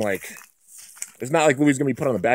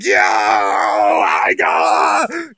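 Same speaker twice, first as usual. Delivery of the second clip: loud, drawn-out crowd shout; long hollered mock cheer; hyped; 3 semitones lower, 5 dB louder, much slower than usual. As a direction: imitate hyped fans yelling, stretching it out. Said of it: "both got so hyped".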